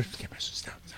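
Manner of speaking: whispered urgent stop; whispering. Real, not acted. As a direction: hiss it under your breath. Hushed aside.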